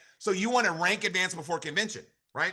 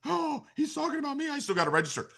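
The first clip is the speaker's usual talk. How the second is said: caught-out listener. Retold or imitated. An unregistered listener realizing the coach means him, mock guilty. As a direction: play the one who just got called out. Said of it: "Some of you are like".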